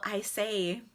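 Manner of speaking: ordinary talk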